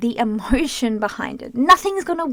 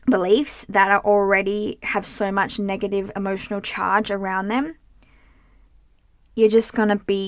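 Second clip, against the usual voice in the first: ordinary talk, nothing marked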